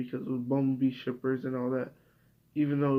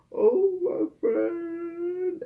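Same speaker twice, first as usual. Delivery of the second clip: very high, very slow mock sob; mock crying Ruby; 4 dB louder, much slower than usual. Mocking Ruby's tearful reunion with her friend, very high slow mock sob. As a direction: wail it like an overacted weepy cartoon heroine. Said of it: "ruby was like".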